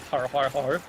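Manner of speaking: low, hammed-up har har har; deep mock laugh; mock pirate laugh. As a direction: chuckle it out like a cartoon pirate.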